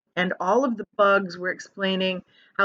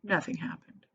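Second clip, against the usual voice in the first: ordinary delivery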